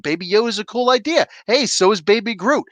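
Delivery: usual speaking voice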